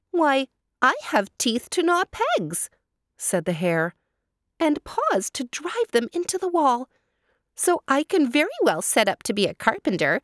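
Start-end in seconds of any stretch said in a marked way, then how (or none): 0.1-2.7 high, lively hare voice; high eager hare, faster toward the end; chatty hare. A narrator's reading. Hare boasts of skills. 4.6-10.2 high, lively hare voice; high eager hare, faster toward the end; chatty hare. A narrator's reading. Hare boasts of skills.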